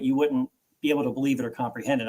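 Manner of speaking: ordinary delivery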